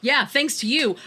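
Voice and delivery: normal talking voice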